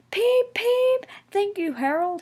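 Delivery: very high peep peep then thanks; high cheerful peeps and thanks; whispered thanks. A narrator's reading. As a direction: toot happily then thank him. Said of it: "he whispered".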